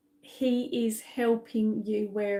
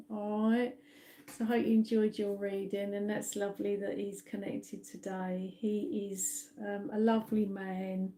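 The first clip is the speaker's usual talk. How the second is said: usual speaking voice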